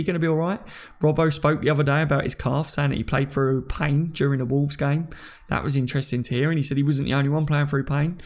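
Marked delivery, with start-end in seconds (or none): none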